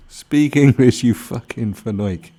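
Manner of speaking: a loud playful yell, slowing down; mock shout. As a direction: bellow it at the video. Shouting at foreign streamer.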